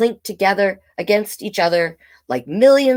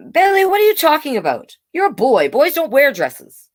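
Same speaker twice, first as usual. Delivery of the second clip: higher, louder, fast dismissive dad; father scolding the child; exasperated father; 9 semitones higher, 4 dB louder than usual. Acted. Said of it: "said her father".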